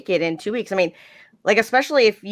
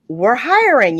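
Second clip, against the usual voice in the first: louder, raised quote of eager recruiters; eager recruiting; 4 semitones higher, 6 dB louder, slower than usual. Retold or imitated. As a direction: imitate recruiters making a pitch. Quoting dispatchers' recruiting pitch.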